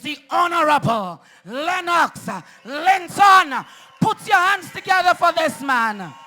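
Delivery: roaring introduction of the leader; rally shout. Real, not acted. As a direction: bring him on with a roar.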